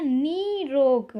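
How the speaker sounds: निरोग said very slowly; slow dictation. Shown as a demonstration. Teacher slowly reads the word निरोग to students. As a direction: draw out निरोग, long नि. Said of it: "अशुद्ध में दिया गया है निरोग".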